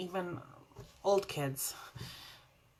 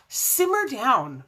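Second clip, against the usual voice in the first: playful scold; 5 semitones higher, 8 dB louder, slower than usual. Acted.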